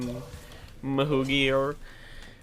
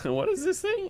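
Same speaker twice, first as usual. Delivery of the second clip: squeaky laughing disbelief, much higher; surprised laugh. Real, not acted. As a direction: laugh out the question in amazement. Finds a weird pillow gun.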